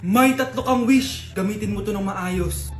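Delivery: solemn genie. Acted. Genie warning the boy about his wishes, low and slow. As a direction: grant the wishes gravely.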